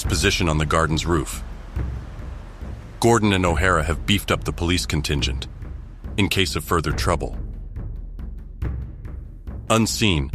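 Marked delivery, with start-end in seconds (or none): none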